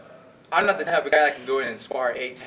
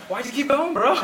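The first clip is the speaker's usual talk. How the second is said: teasing; 12 semitones higher, 6 dB louder than usual. Retold or imitated. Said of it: "I was just playing with him".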